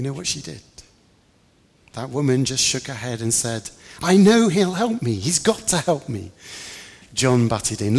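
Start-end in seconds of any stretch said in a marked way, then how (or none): 4.0-6.3 loud, high, desperate insistence; persistent pleading. A narrator's reading. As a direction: refuse to be turned away. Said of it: "louder and more persistent she was".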